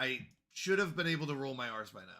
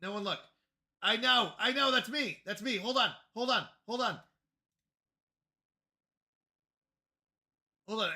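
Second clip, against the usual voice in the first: higher, louder flustered rush; 6 semitones higher, 4 dB louder than usual. Real, not acted. Streamer scrambling to fix overlays, flustered higher cries. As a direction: fluster through it, flapping.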